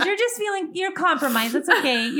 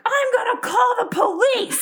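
very high, loud retold scream; 13 semitones higher, 6 dB louder than usual. Nanny in her car screaming at rock-throwing boys, shrill. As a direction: shriek the threat at full pitch. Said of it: "I scream at them".

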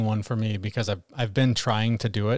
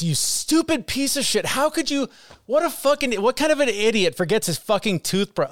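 inner critic berating; 10 semitones higher than usual. Retold or imitated. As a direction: spit it out like a cruel inner voice tearing yourself down. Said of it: "And the voice started".

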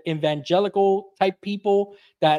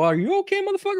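much higher, hammed-up indignant echo; squeaky mock-offended comeback; mock outraged; 12 semitones higher, faster than usual. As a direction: throw his question back, offended. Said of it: "And I go".

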